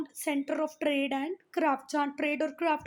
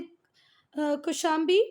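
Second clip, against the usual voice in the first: normal talking voice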